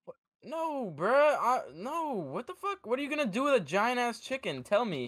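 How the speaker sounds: outraged disbelief. Real, not acted.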